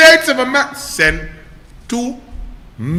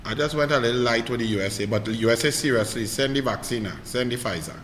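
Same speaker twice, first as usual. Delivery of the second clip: low serious aside; 11 semitones lower, 12 dB quieter, faster than usual. Real, not acted.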